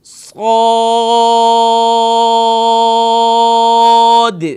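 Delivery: صاد drawn out very long, full-mouthed and loud; tajweed practice, long madd. Shown as a demonstration. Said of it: "full mouth کریں گے ہر حالت میں".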